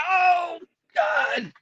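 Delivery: very high, crying shout of pain. Retold or imitated. Husband reliving the painful pimple, high crying shout. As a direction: wail it out like the pain just hit.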